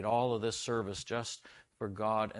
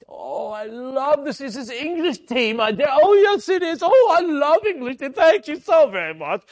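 a dad playing a grand English gentleman at his daughter's pretend tea, high and loud; hammed-up English tea guest; 15 dB louder than usual. Dad plays English tea party.

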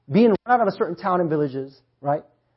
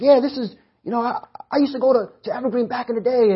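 higher-pitched pretend voice of the caller; put-on caller voice; 5 semitones higher than usual. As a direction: mimic a pastor reminiscing on the phone. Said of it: "I'm just pretending that he talks like that".

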